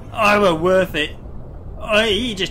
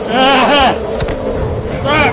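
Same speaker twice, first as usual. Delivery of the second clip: a loud jolt of real fright; startled loud curse; 4 semitones higher, 6 dB louder than usual. Real, not acted. Player ambushed by the Reaper, a loud startled curse. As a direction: caught off guard and swearing loud. Sudden monster attack.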